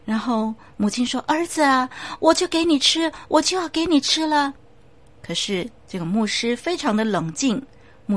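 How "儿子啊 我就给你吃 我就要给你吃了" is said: high, pleading cry to her son; anguished mother. Acted. The mother calls to her dead son, high and anguished. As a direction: she gives in to her grief.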